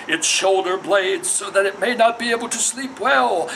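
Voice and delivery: rising, chanting litany of curses; a priest calling on a spirit; incantation. Acted. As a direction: intone it like a ritual spell, building. Ritual incantation within the poem.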